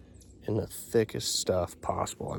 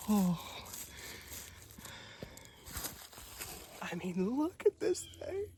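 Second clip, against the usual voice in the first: much higher, breathless amazement; amazed; 4 dB quieter than usual. Real, not acted. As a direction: gush at the sight of the antlers. Marvelling at antler size.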